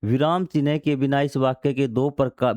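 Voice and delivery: ordinary talk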